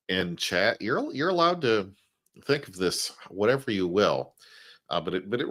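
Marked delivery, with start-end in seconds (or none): none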